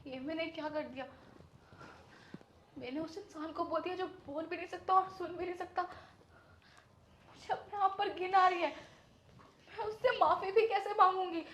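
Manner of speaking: quiet distraught regret after the realisation; remorseful. Acted. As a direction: speak to yourself, crushed with guilt. Remorse after learning he's deaf.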